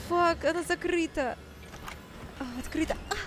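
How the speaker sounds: ordinary talk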